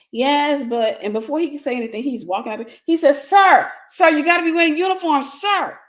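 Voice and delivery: loud, high call after someone walking out; calling after him. Retold or imitated. Quoting worker calling after cop.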